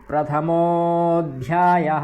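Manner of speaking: प्रथमोऽध्यायः said slowly, every syllable full; careful recitation. Shown as a demonstration. Teacher showing students the exact chapter heading. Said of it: "बारीक उच्चारण करना आना चाहिए".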